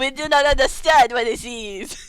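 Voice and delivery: botched mock French; bad French accent. Townsfolk baffled by the players, in badly faked French. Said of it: "That's not even French".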